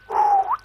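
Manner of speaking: big high breathy sigh of relief; heavy sigh. Retold or imitated. Sick teen in the school toilet stall, heavy sigh. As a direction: let the air out hard, trying to settle.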